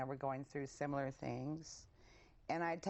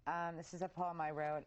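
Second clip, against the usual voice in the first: ordinary delivery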